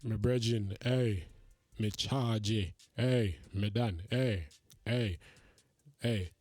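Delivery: mock Jamaican patois. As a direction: tease him in a hammed-up Jamaican accent. Mocking gym filmers in put-on patois.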